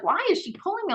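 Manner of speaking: sudden high-pitched puzzled question; suddenly thrown off balance, ask it high and confused; startled question. Retold or imitated. Staff member yanked mid-hold, asking in high alarm. Coworker yanking her during a hold.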